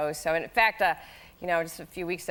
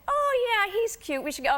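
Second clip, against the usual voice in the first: cheerful husband. Retold or imitated. Voicing husband agreeing happily.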